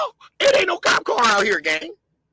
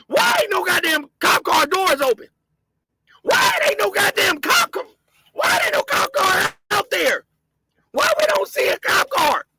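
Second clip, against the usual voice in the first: outraged shouting; 7 semitones higher than usual. Real, not acted. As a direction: yell the questions in disbelief. Outraged rant at missing cop cars.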